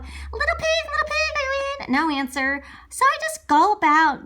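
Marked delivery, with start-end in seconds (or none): 0.0-1.9 calling out. Acted. Wolf calls at pig's door.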